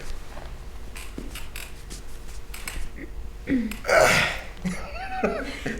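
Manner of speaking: long breathy mock sigh; exasperated sigh; imitated sigh. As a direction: sigh like a tired coach. Rob's sigh before speaking.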